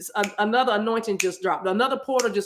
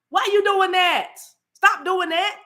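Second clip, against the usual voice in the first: high, louder scolding as Spirit; voicing Spirit; 10 semitones higher, 4 dB louder than usual. Preacher voicing Spirit to the congregation, high and scolding. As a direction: Spirit chiding you to stop. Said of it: "Spirit spends so much time saying".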